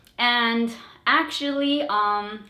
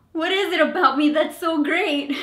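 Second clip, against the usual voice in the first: high, louder panicked question; panicked self-question; 5 semitones higher, 4 dB louder than usual. Retold or imitated. To herself, panicking before the interview question, high and anxious. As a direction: blurt it out in a panic.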